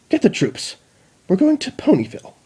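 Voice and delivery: high, fast urgent orders; rally the troops; commanding queen. Acted.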